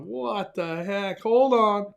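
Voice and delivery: usual speaking voice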